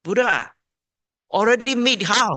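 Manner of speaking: Ananda's question. Retold or imitated. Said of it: "Ananda asked".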